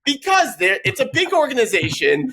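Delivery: urgent pleading. Retold or imitated. Retelling a plea to dad.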